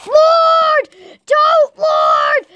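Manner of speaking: high, loud cry; distressed shout. Acted. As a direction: shout it out in exasperation. Crying out after dreading the day.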